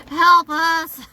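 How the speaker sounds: high, loud pleading creature voice; alien voice. Pretend. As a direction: squeak it out like a tiny alien. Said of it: "that sounded like an alien".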